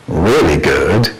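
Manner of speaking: slow, loud, drawn-out relish; loud delighted. Shown as a demonstration. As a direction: savour it loudly and slowly. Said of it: "repeated line, second ending differs".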